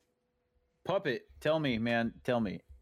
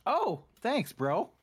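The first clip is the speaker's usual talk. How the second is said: much higher-pitched sarcastic thanks; mock gratitude; 10 semitones higher, 3 dB louder, much slower than usual. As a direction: play at being grateful for awful news, pitch jumping up. Mock reply to the dire warning.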